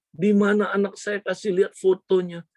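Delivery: high-pitched, urgent mother's demand; acted desperate pleading. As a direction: plead for a missing child.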